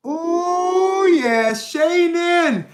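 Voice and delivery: high, stretched delighted greeting; excited greeting. Real, not acted. Streamer greeting Shannon in chat, high and drawn out. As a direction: coo the name slowly, high.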